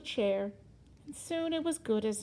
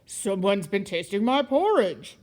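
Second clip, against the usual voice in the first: lightly voiced Papa Bear, louder; great big bear voice; 3 semitones lower, 6 dB louder than usual. A narrator's reading. Papa Bear finds his porridge tasted, lightly done big loud voice. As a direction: give it a big bear's weight. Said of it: "said Papa Bear in his great big voice".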